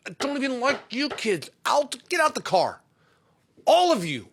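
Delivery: put-on high, loud yelling at the kids; hammed-up parental outburst, shouted high; furious dad. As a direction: blow up and order everyone out. Acted dad throwing kids out of car.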